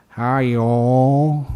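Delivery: imitating a thick Southern American accent. Host imitates a monk from Tennessee, thick Southern American accent. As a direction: have a go at a drawling Southern US accent. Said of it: "such a thick Southern American accent".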